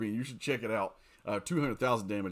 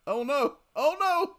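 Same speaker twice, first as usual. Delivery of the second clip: alarmed; 14 semitones higher, 6 dB louder, slower than usual. Real, not acted. Losing a fight in game.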